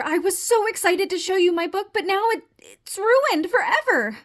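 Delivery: high, whiny lament; wailing despair. Acted. Frog's book is ruined, wailing despair.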